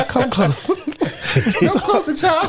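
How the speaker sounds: high, slow, cackling come-closer; creepy old witch voice. Pretend. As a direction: lure them in like a witch.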